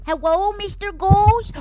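high, loud, drawn-out cutesy call; ooh-voicing. Player calls out to the ghost, full-on ooh voice. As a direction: sing out a loud, rounded, high greeting. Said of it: "[name]'s like this full-on ooh voicing".

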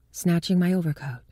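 ordinary delivery, nothing marked

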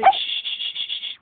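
hushed shushing; soothing a horse. Real, not acted. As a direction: shush her gently, calm her down. Hushing the horse.

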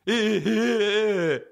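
high, long mock giggle; imitated laugh. Buddy laughing despite being told off, imitated high giggle. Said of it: "He laughs at everything".

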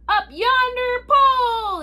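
high, loud, drawn-out crew shout; sustained high-pitched chorus yell. Acted. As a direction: holler it slowly like the whole crew echoing. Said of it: "the others shouted".

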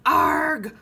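loud, high growl of annoyance; pirate holler. A narrator's reading. Pirate captain hearing the baby, loud holler. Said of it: "hollered Braidbeard".

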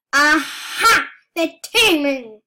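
loud triumphant discovery; triumphant aha. Real, not acted. Player catching rivals teaming, loud triumphant aha. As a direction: call it out like you caught them.

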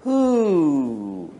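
one long, falling owl hoot; drawn-out "hooo". Acted. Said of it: "he just goes".

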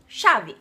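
"chave" com som de "ch" no início; chiado argentino. Shown as a demonstration. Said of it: "eles falam muito chiado". ("chave" chiado, à argentina)

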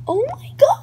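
high, rising squeal of disbelief; freaking out. Real, not acted. Vlogger realizes it's ten thousand away, freaking out. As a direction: gasp it out high, truly overwhelmed. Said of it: "I'm freaking out".

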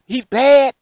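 little brother telling Dad Kevin is bad; tattling little brother. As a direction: declare it like a tattletale. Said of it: "He said".

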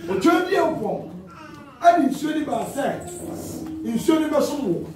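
high, slow invocation; calling on God. Acted. Preacher acts out addressing God about the river, high slow call. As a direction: pitch it high and stretch each phrase.

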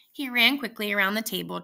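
ordinary delivery, nothing marked

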